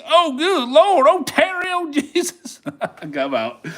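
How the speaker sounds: very high, laughing oh good Lord; laughing disbelief. Real, not acted. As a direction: crack up as it sweeps. Trade swept, laughing in disbelief.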